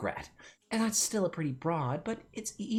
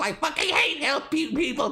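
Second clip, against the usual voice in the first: angry shout; 7 semitones higher than usual. Acted. Angry rant.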